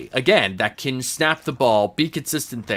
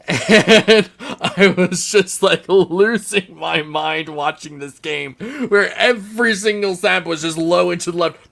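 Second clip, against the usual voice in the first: raised, louder, incredulous retelling; laughing exasperation; 6 semitones higher, 4 dB louder than usual. Retold or imitated. Fan recalling a game of botched snaps, exasperated and laughing. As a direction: relive losing your mind, half laughing.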